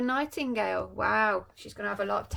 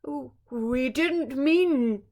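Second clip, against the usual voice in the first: slow, faltering scared king; the king, frightened, stammering to Nightshade; 3 semitones higher, 8 dB louder, slower than usual. Acted. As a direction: stumble over the first words. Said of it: "the king stammered".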